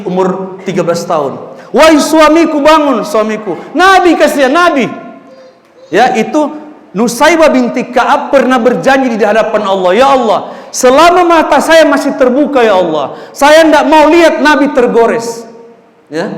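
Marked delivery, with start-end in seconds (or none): 1.7-4.9 urgent wake-up call. Acted. Wife waking husband urgently. 10.0-15.4 fervent vow. Acted. Vow before God, dramatic.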